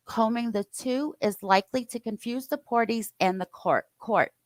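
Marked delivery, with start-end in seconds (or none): none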